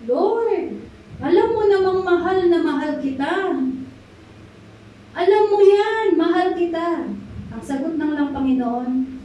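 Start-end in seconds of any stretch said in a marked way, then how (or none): 0.1-7.1 higher, pleading and a little wounded; sulky, insistent plea of love; hurt sulking reply. Acted. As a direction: answer like a friend who feels doubted. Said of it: "Nagtampo na si Peter".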